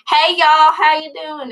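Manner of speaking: a light put-on Southern accent saying hi. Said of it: "I pick it up when I'm around them".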